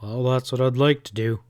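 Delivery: normal talking voice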